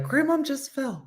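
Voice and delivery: high, startled announcement; mock alarm. Dean telling everyone grandma fell, in mock alarm. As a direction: blurt it out like a kid seeing grandma drop. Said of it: "And Dean was like".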